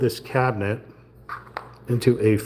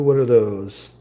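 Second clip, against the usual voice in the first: ordinary talk, nothing marked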